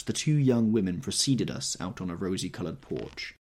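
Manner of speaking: ordinary delivery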